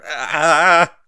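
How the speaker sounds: sharp loud cry of pain; pained yelp. A narrator's reading. Stranger in an arm lock, crying out loudly in pain.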